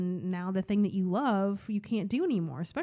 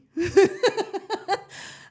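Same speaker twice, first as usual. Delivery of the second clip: a bright burst of real laughter, high and louder; 12 semitones higher, 6 dB louder than usual. Real, not acted. Real high laugh after joking to viewers.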